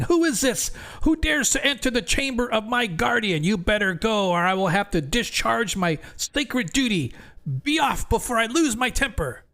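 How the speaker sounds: very high, squeaky little creature warning; high squeaky crayfish threatening intruders. Acted. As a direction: squeak it out like a tiny self-important guardian. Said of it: "a very high-pitched, squeaky voice saying".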